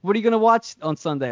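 quick, high, bright mock asking voice; mock eager question. To a friend, asking about Sunday's pay-per-view, quick and high. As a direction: play a friend keenly asking about weekend plans. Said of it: "you're like".

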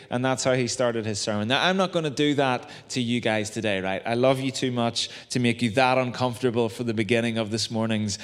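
ordinary delivery

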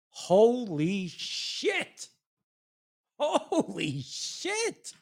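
drawn-out, incredulous amazement; slow, astonished exclamation; stunned disbelief. Real, not acted. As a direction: stretch each word out, rising at the end. Reacting to an absurd quote.

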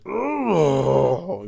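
drawn-out growling "ugh" of rage; angry groan. Retold or imitated. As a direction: groan out pent-up fury, acted out. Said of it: "I just be pissed off, like, just".

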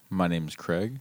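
low, soft seductive voice; sexy voice. Pretend. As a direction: croon it low and smooth. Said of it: "you have your sexy voice on".

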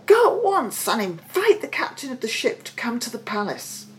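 high, wondering king; amazed cry. A narrator's reading. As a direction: marvel aloud in a high voice. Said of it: "he cried".

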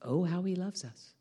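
low, soft, lingering delivery; tender awe. Real, not acted. Preacher speaks of God's love to congregation, tender awe. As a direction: hush it into quiet wonder.

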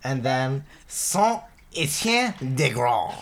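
slow, hammed-up French accent; deliberately bad French accent, drawn out. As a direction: mangle the names in a silly French accent. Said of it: "really bad French accent version".

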